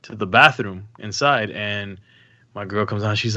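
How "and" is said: usual speaking voice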